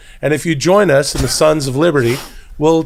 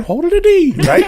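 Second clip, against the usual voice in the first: high pitch. Shown as a demonstration. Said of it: "You gotta go up".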